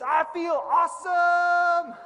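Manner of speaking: slow, high, loud elated cry; bursting with joy; elated shout. Retold or imitated. Photographer shouts his elation after yelling the affirmation. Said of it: "He says".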